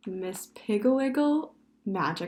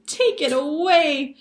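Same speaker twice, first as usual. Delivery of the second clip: high, loud squealed plea; 8 semitones higher, 10 dB louder than usual. A narrator's reading. Girl wanting the frog gone, squealing. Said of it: "squealed Sally".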